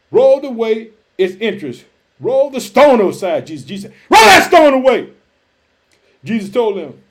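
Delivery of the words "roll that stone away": loud, raised-pitch command; shouted command. Acted. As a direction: shout it as an order from Jesus. Jesus' command repeated as a shout.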